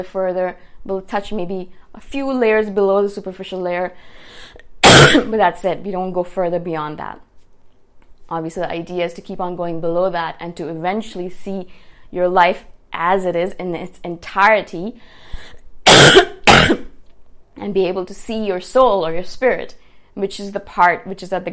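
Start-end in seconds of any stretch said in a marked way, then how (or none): 4.0-4.8 quick stifled cough; muffled cough. Real, not acted. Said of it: "Sorry about all the coughing". 15.2-17.5 loud rasping coughs; coughing fit. Real, not acted. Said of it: "Sorry about all the coughing".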